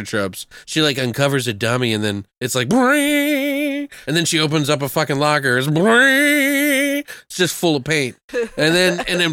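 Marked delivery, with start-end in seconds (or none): 2.7-3.9 high mimicked horror sting; sting noise. 5.7-7.0 high mimicked horror sting; sting noise.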